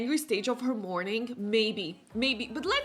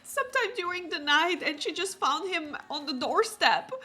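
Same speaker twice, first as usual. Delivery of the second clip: higher, laughing through faked tears; hammed-up grief, half giggling; mock tearful news; 7 semitones higher, 6 dB louder than usual. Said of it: "so she is like".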